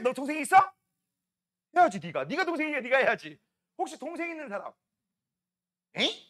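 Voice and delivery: ordinary delivery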